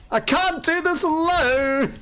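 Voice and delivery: high, whiny cry of mock despair. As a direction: wail it like you are abandoned, hammed-up. Mock desperate plea.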